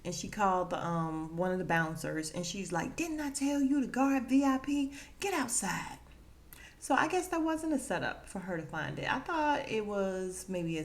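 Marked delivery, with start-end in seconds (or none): 3.0-6.0 higher mock-stern telling-off; acted scolding. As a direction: dress down a bouncer who failed. Madam tells off the bouncer, acted.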